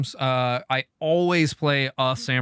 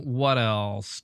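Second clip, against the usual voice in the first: ordinary delivery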